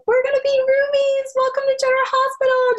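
very high, fast, bright welcome; high, quick imitation of a bubbly colleague; bubbly friendly greeting. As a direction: greet her like a bubbly roommate.

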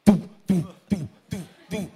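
imitated heartbeat thumps; short punched doo, doo beats; pounding heartbeat. Telling the audience how his heart pounded, imitated heartbeat. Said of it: "my heart is like".

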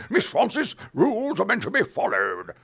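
high, loud stiff British colonel; loud pompous British reprimand; Mister Harriman. Retold or imitated. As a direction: scold her like a stern colonel. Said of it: "Mister Harriman".

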